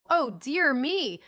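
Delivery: dismayed storybook exclamation; crying out. A narrator's reading. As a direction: draw out the cry in dismay. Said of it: "cried Van".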